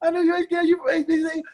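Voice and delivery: high, strained raspy taunting voice; David Little. Retold or imitated. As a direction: squeeze it up high and needle the rookie. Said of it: "and then he go".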